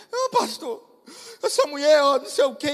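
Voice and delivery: high, whiny mock protest; hammed-up tearful woman; imitated crying wife. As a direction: plead to the pastor in mock tears. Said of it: "e chorando".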